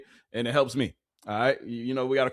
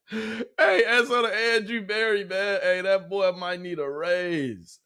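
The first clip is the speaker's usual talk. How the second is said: laughing hype; 7 semitones higher, much slower than usual. Real, not acted.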